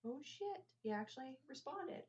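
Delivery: high, soft mock amazement; quiet, high-pitched retold surprise; retold astonishment. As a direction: replay a stunned reaction, high and hushed. Retelling her astonished reaction.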